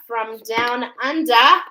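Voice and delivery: mock Aussie twang on "down under", with an open "dah" ending on "under"; Australian accent. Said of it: "don't you love my accent?".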